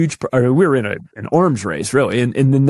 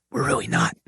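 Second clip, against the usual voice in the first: soft, breathy, unvoiced aside; whispered; 6 dB quieter than usual. Shown as a demonstration. Host confides that America is not leading in science, whispered. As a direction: lean in and whisper it like a secret. Whispered repeat of own claim.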